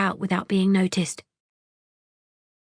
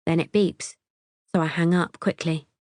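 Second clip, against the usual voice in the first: usual speaking voice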